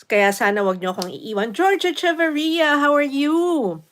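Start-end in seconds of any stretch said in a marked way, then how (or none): 1.5-3.8 excited greeting. Real, not acted. Greeting a viewer on the livestream.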